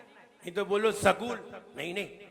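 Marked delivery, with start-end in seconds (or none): 0.9-1.6 "सकूल" with a full sa at the start; wrong pronunciation. Shown as a demonstration. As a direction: say "सकूल" as sa-kool. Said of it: "सा पूरा नहीं करना है".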